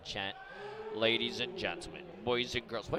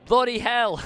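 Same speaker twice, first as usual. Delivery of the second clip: loud, much higher Scottish "Bloody hell"; mock Scottish accent; 13 semitones higher, 15 dB louder than usual. Streamer tests a Scottish accent on chat, shouted mock Scottish. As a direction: blurt it in an overdone Scottish accent. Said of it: "Is that how you do it?".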